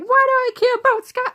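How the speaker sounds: high, loud mock-indignant cry; exasperated outburst. Daughter snapping back at mom over Scotland, loud exasperated outburst.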